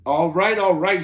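screeching. A narrator's reading. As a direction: screech out the surrender. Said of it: "he screeched".

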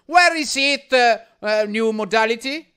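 put-on loud broken English, Italian accent. Prank call in broken English.

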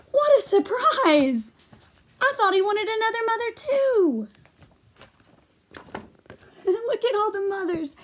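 thrilled surprise; excited surprise. Real, not acted. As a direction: gasp with delight at the ending. Teacher delighted at surprise ending.

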